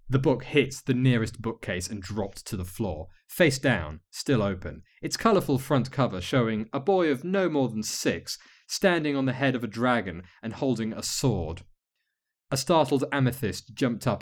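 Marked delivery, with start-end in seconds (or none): none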